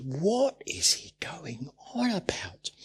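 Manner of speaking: hushed puzzled whisper. Real, not acted. Preacher puzzling aloud to the congregation, whispered. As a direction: whisper the question as if confiding.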